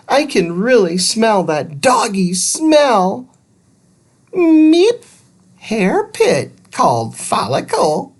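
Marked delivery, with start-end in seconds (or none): 4.3-8.1 high beeping robot voice, broken grammar; robot Thud. A narrator's reading. Little robot explaining follicles, high beep first. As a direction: beep then explain simply.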